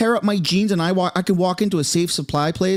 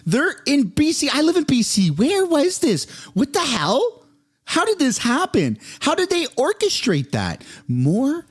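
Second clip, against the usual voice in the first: high-pitched, incredulous outburst; shocked disbelief; 10 semitones higher than usual. Real, not acted. B.C. resident hearing of local super lab, shocked. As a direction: fire off questions in high-pitched alarm.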